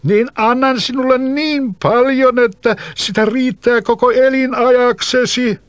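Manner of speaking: put-on bottled spirit calling out to the boy; spirit shouting. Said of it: "huusi henki".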